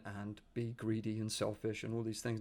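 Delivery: ordinary talk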